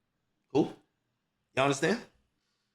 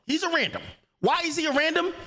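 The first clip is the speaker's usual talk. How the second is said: pitched way up in disbelief; mock the idea, voice jumping high; high, scornful disbelief; incredulous; 10 semitones higher than usual. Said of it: "and I'm saying".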